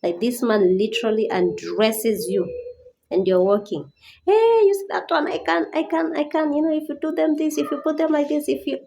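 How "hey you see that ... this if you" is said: high, exaggerated imitation of crude men; mock leering voice, much higher. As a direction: send up the catcalling men. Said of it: "men will be describing".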